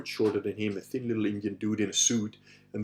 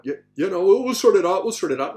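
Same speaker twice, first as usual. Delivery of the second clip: flustered thug; 8 semitones higher, 9 dB louder than usual. Retold or imitated. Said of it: "it's like".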